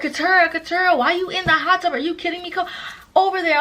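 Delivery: high, incredulous mock call to a friend; imitated friends calling. Friends urge her out of the hot tub, high mock calling. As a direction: imitate friends calling someone over in disbelief.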